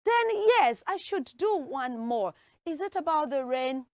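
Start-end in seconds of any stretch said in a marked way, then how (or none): none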